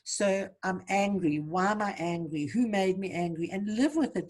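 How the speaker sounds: normal talking voice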